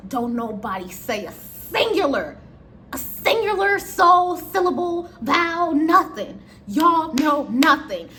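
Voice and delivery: "singular", "syllable", "vowel", "nothing" each hit slowly and hard; slow, loud, word-by-word order with "nothing" punched; emphatic warning. Acted. Prepping coworkers to keep quiet.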